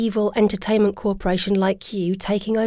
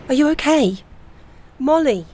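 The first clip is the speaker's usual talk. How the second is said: urgent concern; 4 semitones higher than usual. Acted. Checking on collapsed friend.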